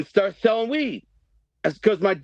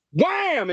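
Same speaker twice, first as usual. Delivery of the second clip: punch sound; 12 semitones higher than usual. Retold or imitated.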